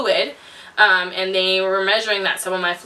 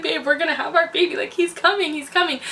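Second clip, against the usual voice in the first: higher, quick, breathless excitement; excited to husband; 7 semitones higher than usual. Retold or imitated. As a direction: tell your husband the baby is coming. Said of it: "I looked at my husband like".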